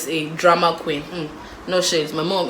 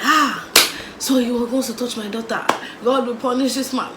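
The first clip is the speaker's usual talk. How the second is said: higher, dramatic Nigerian mom cursing; dramatic mom curse; 5 semitones higher than usual. Retold or imitated. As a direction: ham up the outraged mother. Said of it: "So she goes off like".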